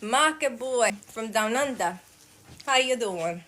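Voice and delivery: mock Aussie greeting, not quite landing; attempted Australian accent. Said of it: "I tried to do your accent".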